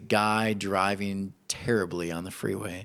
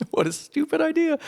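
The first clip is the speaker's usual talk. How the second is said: laughing; 3 dB louder than usual. Real, not acted. Laughs at own assumption.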